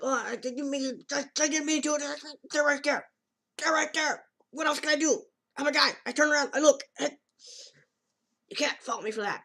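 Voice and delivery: high, loud, panicky excuses; hammed-up flustered guy; flustered boy. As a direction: babble out excuses caught looking. Boy flustered defending himself.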